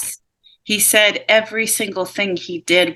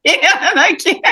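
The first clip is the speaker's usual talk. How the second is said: breaking into high laughter; can't help cracking up; laughing at the spirit's odd wish, higher; 12 semitones higher than usual. Real, not acted.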